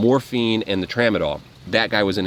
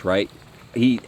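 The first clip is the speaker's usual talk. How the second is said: ordinary delivery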